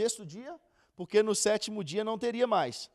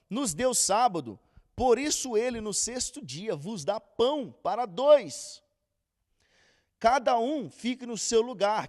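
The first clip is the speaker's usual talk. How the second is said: slow, louder Bible reading voice; scripture reading; 3 semitones higher, 7 dB louder than usual. Acted. Teacher reading Exodus to the group, slow and solemn. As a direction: read the verses out with weight, unhurried. Reads verse twenty-nine.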